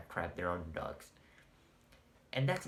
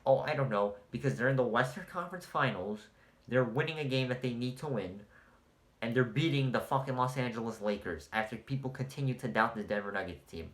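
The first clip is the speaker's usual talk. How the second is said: ordinary talk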